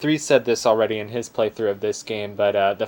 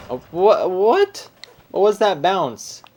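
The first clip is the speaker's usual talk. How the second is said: startled; 10 semitones higher, 6 dB louder, slower than usual. Real, not acted. Startled by a sudden bounce.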